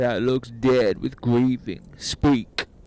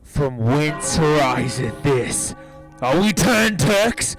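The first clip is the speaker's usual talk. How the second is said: loud, measured authority; commanding rebuke; 8 dB louder than usual. Acted. General shames his brawling soldiers, loud commanding rebuke. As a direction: thunder a stern public rebuke.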